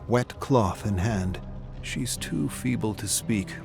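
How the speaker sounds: ordinary delivery, nothing marked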